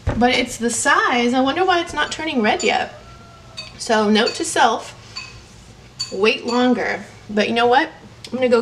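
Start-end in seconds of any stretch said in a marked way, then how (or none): none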